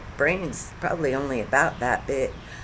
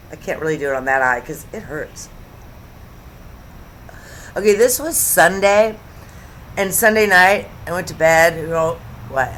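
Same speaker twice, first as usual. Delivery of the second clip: ordinary talk, nothing marked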